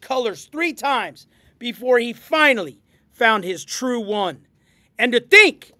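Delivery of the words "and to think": loud, indignant lizard hiss. A narrator's reading. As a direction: hiss it out in outrage. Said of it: "he hissed".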